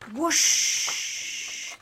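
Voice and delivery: long airy whoosh; a loud rush of breathy sound; whoosh noise. A narrator's reading. Narrator imitates something rushing suddenly past, whooshing.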